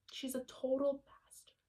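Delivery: secret whispered aside. Real, not acted. Whispering to viewers about her religion teacher. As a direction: hush it like a guilty confession.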